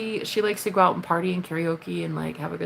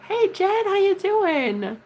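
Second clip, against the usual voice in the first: high, drawn-out hello to a friend; excited greeting; 13 semitones higher, 4 dB louder, much slower than usual. Real, not acted. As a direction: gush a real greeting, voice way up. Calling out a greeting to viewer.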